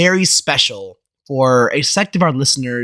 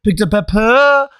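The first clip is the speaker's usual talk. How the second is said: cursive voice; 8 semitones higher than usual. Shown as a demonstration. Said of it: "Now let's do it in cursive".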